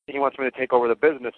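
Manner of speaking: ordinary delivery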